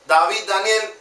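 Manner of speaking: usual speaking voice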